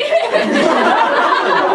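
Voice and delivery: high breathy laugh bursting out; can't hold the laugh in; giggling fit mid-read; burst of laughter. Real, not acted. Laughing during script read.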